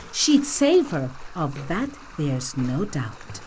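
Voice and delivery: usual speaking voice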